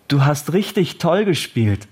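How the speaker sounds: a touch of upbeat encouragement; mildly enthusiastic praise. A narrator's reading. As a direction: praise a child after the match. Parent praises child after game.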